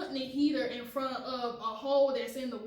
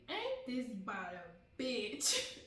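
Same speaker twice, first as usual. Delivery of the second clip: muttered dread; 6 dB quieter, slower than usual. Retold or imitated. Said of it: "I say".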